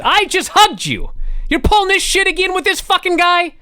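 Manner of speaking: husband's mock fury at the wife; imitating furious Hunter. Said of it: "Hunter is fucking furious".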